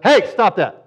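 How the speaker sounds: firm dad voice stopping play; stern dad voice. Shown as a demonstration. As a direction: snap it like a dad rebuking. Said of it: "use your dad voice".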